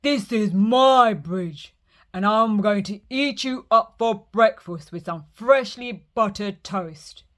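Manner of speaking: loud troll boasting about breakfast; greedy troll. Acted. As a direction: menace the goat with relish. Troll threatens to eat goat.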